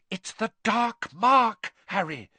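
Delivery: high, drawn-out moan of dread; urgent, frightened wail. A narrator's reading. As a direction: moan it slowly in alarm. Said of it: "Hermione moaned".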